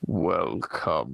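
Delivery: deep, slow haunted house host; low sinister welcome to the mansion; spooky ghost host. Retold or imitated. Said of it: "the voices that you just provided".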